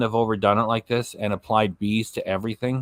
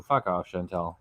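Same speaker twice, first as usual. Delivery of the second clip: muttered dismissal; 7 semitones lower, 5 dB quieter than usual. Real, not acted. Muttered dismissal after mock rant.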